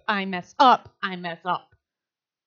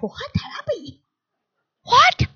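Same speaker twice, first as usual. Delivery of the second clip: put-on kids' disbelief; 9 semitones higher, slower than usual. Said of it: "And they're like".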